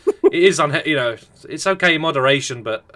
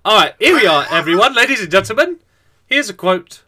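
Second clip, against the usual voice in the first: announcer voice; 4 semitones higher, 5 dB louder than usual. Acted. Introducing comment grandly.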